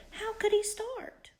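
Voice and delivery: plain reading voice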